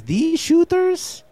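high, slow cartoon mouse voice; Speedy Gonzales voice. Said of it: "Speedy Gonzalez".

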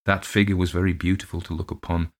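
ordinary delivery

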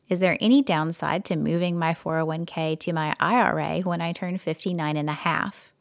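ordinary delivery

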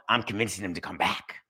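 whispered aside, no voice; a secret murmured behind the hand; hushed aside. Acted. The commissioner confiding to listeners in a whisper.

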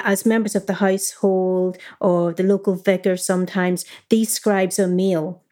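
ordinary delivery

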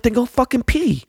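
high, exasperated mock yell at the kid. Dad yelling at his son at dawn, high mock yell. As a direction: squeal it out way up high, fed up. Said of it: "I'm like".

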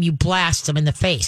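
ordinary delivery

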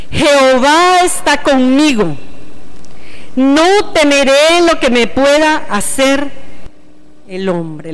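loud, slow, declamatory psalm reading; scripture read aloud. Acted. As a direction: lead a congregation through the verse, dropping at the close. Leads joint psalm reading.

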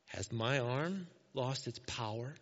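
low, slow, measured rhetorical question; the Lord's reply. Acted. The Lord answering Moses's doubt, low measured challenge. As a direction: ask it slowly with quiet authority. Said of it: "Then the Lord said to Moses".

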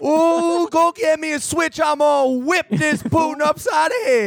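high, slow, hammed-up Southern holler; mock Southern scold. Southerner threatening to whip Putin, high mock Southern drawl. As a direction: wail it like an outraged Southern elder. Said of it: "Joe was talking about Putin invading Georgia".

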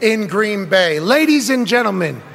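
announcer intro. Acted. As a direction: bring in the star like a ring announcer. Building guest introduction.

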